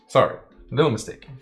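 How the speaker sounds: ordinary delivery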